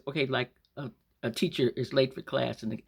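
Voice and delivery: ordinary delivery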